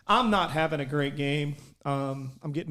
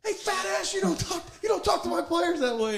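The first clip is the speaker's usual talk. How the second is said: very high, strained yell with the volume held back; mock coach yell; 13 semitones higher than usual. Player replaying coach scolding rival for his players, held-back yell.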